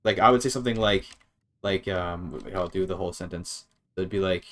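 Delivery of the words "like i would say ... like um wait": ordinary delivery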